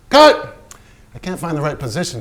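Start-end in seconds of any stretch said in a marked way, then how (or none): 0.1-0.6 yelled cut, high and loud; furious director yelling cut; director's shout. Acted. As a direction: bark the cut across the set. Director shouting on film set.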